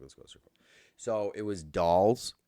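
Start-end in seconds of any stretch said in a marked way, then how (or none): 0.0-0.5 hushed whisper of a sneaky invite. Retold or imitated. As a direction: whisper it like a secret plan. Jared quoted, hushed invitation.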